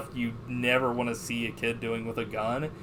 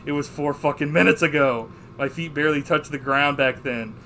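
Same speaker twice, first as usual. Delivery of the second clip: loud, higher, punched-out movie monologue; acted rant; 3 semitones higher, 8 dB louder than usual. Fed-up husband rants about his lost youth, imitated ranting. Retelling the film character's rant.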